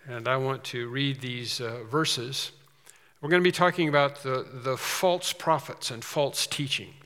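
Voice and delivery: normal talking voice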